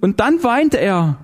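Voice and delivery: high, slow, distraught; frantic telling. A narrator's reading. Zinzendorfer ending her account of the demon, frantic. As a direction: stay high and draw the words out.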